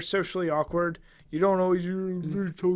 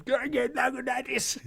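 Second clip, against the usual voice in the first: high, slurred Can I get Lagunitas; mock the garbled order; mocking Jim garbling his beer order; mumbled order; 7 semitones higher than usual. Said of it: "this asshole comes".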